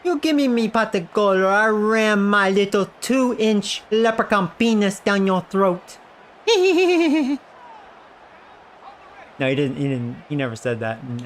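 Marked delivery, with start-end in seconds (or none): 0.0-6.0 leprechaun voice. Pretend. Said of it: "I am a leprechaun". 6.5-7.4 high cackle. Pretend. Cackle after leprechaun bit.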